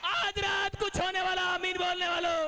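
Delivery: high, loud, shouted preaching; preaching at full force. Acted. Preacher urging the congregation to laugh in joy. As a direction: fire up the church to rejoice.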